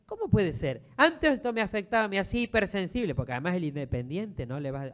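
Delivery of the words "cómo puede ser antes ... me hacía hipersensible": raised, louder voice of happy disbelief; acted amazement. Believer amazed that old slights no longer hurt, acted surprise. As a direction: marvel aloud, high and emphatic.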